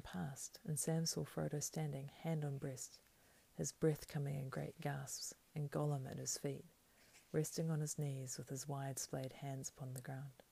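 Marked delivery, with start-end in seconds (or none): none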